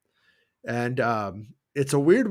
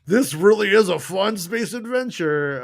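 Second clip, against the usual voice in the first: higher, fake-cheery gushing; sarcastic mock; 8 semitones higher, 3 dB louder than usual. Mocking the hotel experience, fake-cheery voice. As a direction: gush with exaggerated fake delight. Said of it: "It's like".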